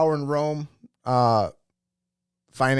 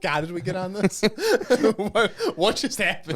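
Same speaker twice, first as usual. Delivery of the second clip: laughing; 6 semitones higher than usual. Real, not acted. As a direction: crack up at how lost you are. Laughing at the tangent.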